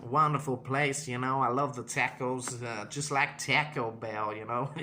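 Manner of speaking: slow, put-on American character; drawn-out American accent with a small chuckle. Shown as a demonstration. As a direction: ramble along in an American voice. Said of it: "kinda like American voice".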